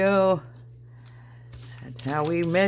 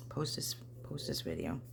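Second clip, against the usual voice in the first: whisper; 12 semitones lower, 12 dB quieter than usual. Real, not acted. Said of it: "Why am I whispering?".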